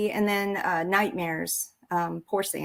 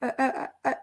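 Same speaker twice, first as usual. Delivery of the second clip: higher and fast, stammering through rising tears; mock tearful panic; 7 semitones higher, faster than usual. Veteran retelling her closet meltdown, higher, fast, stammering mock crying. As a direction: blurt it out like you're bawling in the closet. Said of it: "just bawling".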